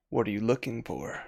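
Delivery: hushed, lightly voiced unseen creature; voice in the head. Game master as a voice in the player's head, quiet. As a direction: speak softly, as if inside their head. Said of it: "you hear this voice in your head that says".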